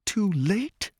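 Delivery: Paige's alarmed high question; Paige's echo. A narrator's reading. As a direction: echo his words in disbelief. Paige echoes the man.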